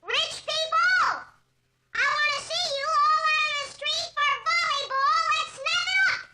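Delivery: high, loud hollering in an empty street. Retold or imitated. [name] imitating Murray hollering at rich people. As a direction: holler at the apartment houses. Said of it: "he hollers".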